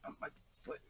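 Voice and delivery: hushed, barely voiced mumble of hurt; tiny suppressed complaint under the breath; hiding pain. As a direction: keep the pain to yourself, almost whispering. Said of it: "if someone's nearby and sees them".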